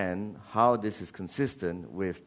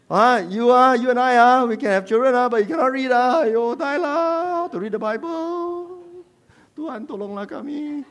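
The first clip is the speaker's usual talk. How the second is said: high, loud wailing lament; mock despair; 11 semitones higher, 6 dB louder than usual. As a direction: wail it, stretching the ends. Translates husband's comic despair.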